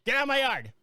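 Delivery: shouted high as the tiny chihuahua; voicing the dog. Host voicing the chihuahua, shouting at the coyote. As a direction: bark it out like a fierce little dog.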